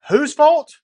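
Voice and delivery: high, loud accusing yell; indignant shout. Acted. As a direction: demand to know who's to blame. DM mock-accusing the player.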